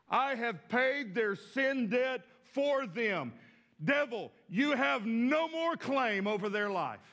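emphatic, measured proclamation. Retold or imitated. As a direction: declare it forcefully, word by weighty word. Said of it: "He cries out in a louder voice".

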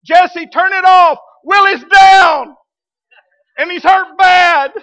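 high, loud, slow urgent shout; yelling. Retold or imitated. Pastor yelling at Jesse to shut off the machine, urgent shout. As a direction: yell across the garage to stop the machine. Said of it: "I yell at Jesse".

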